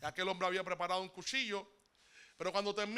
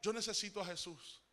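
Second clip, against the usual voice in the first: a hushed, broken plea, much softer; 8 dB quieter than usual. Retold or imitated. The desperate man, still crying, tells the pastor he needs Jesus, in a soft hushed plea. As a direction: barely voice it, the way a desperate man begs. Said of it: "me dice este hombre".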